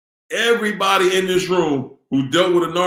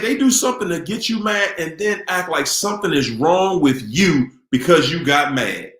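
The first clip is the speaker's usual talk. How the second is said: ordinary talk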